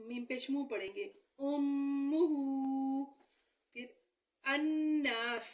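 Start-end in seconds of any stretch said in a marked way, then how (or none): 1.4-3.0 उम्मुहु drawn out slowly, long mm; slow doubled meem. Shown as a demonstration. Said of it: "ये मीम के ऊपर शद है". 4.4-5.5 अन्नास slowly, long nn; slow doubled noon. Shown as a demonstration. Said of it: "हमजा ज़बर नून अन".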